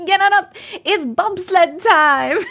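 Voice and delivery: high, loud mock-Jamaican chant; bad Jamaican accent. Streamer quoting Cool Runnings to chat, bad Jamaican accent. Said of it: "That accent is so bad".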